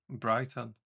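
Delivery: normal talking voice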